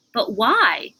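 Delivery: usual speaking voice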